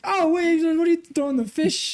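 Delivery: high-pitched, incredulous mock protest; startled protest. Guy pelted with a fish protests, mock startled. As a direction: act out alarmed disbelief, pitch jumping up. Retold shock at thrown fish.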